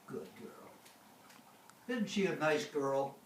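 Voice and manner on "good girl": whispered praise. Real, not acted. Praising the dog.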